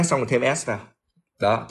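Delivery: normal talking voice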